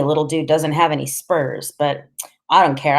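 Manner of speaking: ordinary delivery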